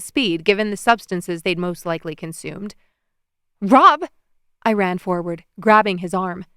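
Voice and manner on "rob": high, loud urgent call; shouting a name. A narrator's reading. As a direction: yell his name to stop him. Calling out to him.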